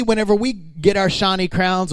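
normal talking voice

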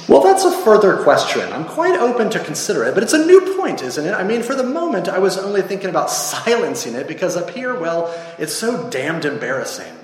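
high, flustered ghost voice; stalling ghost. Acted. Ghost stalls the angel about killing it, high and flustered.

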